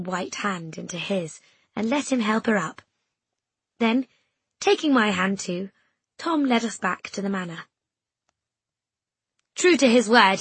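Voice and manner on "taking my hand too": usual speaking voice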